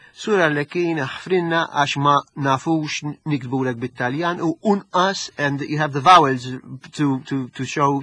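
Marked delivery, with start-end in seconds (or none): 0.1-5.3 slow Gozitan village dialect; Arp dialect. Retold or imitated. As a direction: read it in broad Gozo dialect. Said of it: "So it starts off with".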